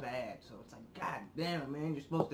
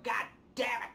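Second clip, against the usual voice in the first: exasperated outburst; 9 semitones higher than usual. Retold or imitated. Acts out frustration at losing everything.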